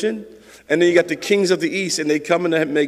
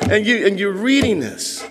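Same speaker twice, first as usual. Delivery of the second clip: ordinary delivery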